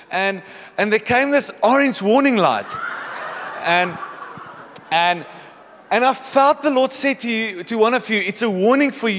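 loud, high testimony voice; urgent retold warning. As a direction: announce the dream forcefully to the room. Retells colleague's warning dream to group.

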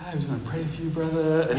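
soft, gentle, unhurried reassurance; calm Jeff. Retold or imitated. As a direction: Jeff calmly soothing the screaming man, softly. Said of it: "Jeff's so calm".

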